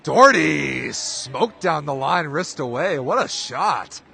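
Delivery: play-by-play erupting over a bomb; excited shout. Real, not acted. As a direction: roar out the big hit. Big kill called live.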